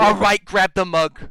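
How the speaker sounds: loud, slow New York dad. Retold or imitated. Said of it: "he's like".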